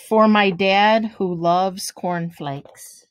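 gentle storybook read-aloud, each rhyme given room; reading to children. Acted. Teacher reading a picture book to kids, lively storytelling. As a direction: read it like bedtime story time for little ones.

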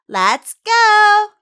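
excited shout. Acted. Launches the practice section.

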